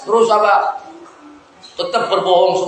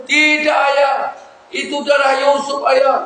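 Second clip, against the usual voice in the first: pleading insistence; 8 semitones higher than usual. Pretend. Sons insist on their lie.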